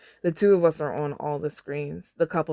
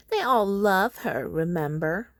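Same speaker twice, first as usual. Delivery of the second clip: higher, slow catty sneer; shrill-voiced girl; 4 semitones higher, slower than usual. District four girl gossiping in the elevator, lightly shrill. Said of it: "She's got a shrill voice".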